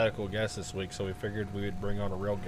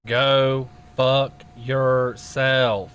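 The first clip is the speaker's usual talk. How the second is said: loud slow drawn-out curse; shouting; 9 dB louder, much slower than usual. Real, not acted. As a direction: bellow it slowly at the phone. Yelling into voicemail.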